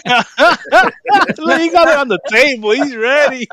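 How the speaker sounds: high, loud laughing talk; words bursting through laughter. Real, not acted. Laughing at the lube on table.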